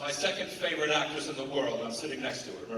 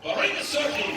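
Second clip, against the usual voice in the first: loud, high introduction to the crowd; proud announcement; 11 semitones higher, 8 dB louder than usual. Real, not acted. Host announces Marina to the convention crowd, proud and loud.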